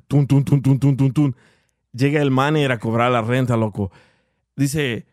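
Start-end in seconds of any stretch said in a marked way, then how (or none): 0.1-1.4 rapid, even door-pounding syllables; imitated hard knocking. Storyteller mimicking the manager at the door, hard knocking. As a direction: knock it out in a steady run of thuds. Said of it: "tocar la puerta bien fuerte".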